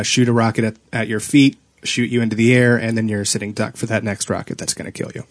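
ordinary talk

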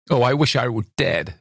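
a mild hint of weeping despair; struggling to speak. A narrator's reading. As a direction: force the words out on the brink of weeping. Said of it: "she struggled to say".

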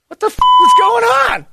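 loud, very high bewildered cry; bewildered high shriek. Retold or imitated. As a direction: shriek it out confused. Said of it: "you leave going".

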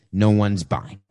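ordinary talk

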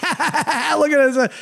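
drawn-out nervous laughing line; slow, high laughing impression of a mobster; Ray Liotta as Henry Hill. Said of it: "Ray Liotta's like".

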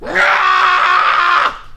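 very high, shrill session scream; long high-pitched yell. Retold or imitated. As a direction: let out a piercing cartoon scream. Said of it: "I'd scream for two hours".

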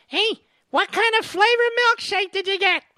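mock a kid reporter yelling for attention; prepubescent Brian Stelter. Host mimicking a young reporter calling out to Biden, squeaky high. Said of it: "prepubescent Brian Stelter".

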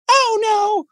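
squeaky chipmunk Oh no; helium voice. Retold or imitated. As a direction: sing it like you've sucked helium. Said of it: "sounds like the person's on helium".

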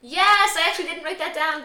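surprised. Real, not acted. Surprised by the count.

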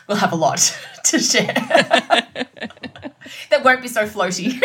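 laughing while talking; trying not to laugh mid-sentence; stifled giggles. Real, not acted. Laughing through closing episode plug.

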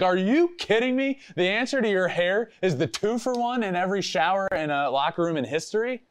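high, disbelieving rant; incredulous outburst. Retold or imitated. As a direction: let it fly up in disbelief. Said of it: "I'm like".

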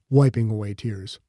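usual speaking voice

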